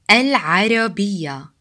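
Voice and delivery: "Al-Arabiya" slowly, with a mid-throat ayn; slow, careful "Al-Arabiya"; ain in Arabic word. Shown as a demonstration. Teacher giving an example word with ain, slow pharyngeal pronunciation. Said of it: "important Arabic words have the ain sound".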